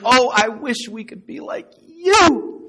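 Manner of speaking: high, loud, slow swooning admiration; mock gushing. Everybody applauding the perfect family, mock gushing. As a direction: gush with hammed-up envy, stretching the last word. Admirers voiced in fairy-tale parody.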